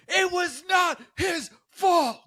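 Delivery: high, slow, shouted defense; high indignant yell. Retold or imitated. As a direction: drag it out, outraged. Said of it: "And she goes".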